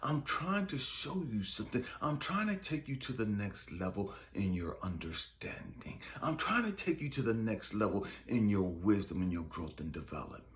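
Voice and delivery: low, steady explaining voice; patient, low-pitched lesson; God. Retold or imitated. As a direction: explain insistently in a deep register. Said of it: "And he said".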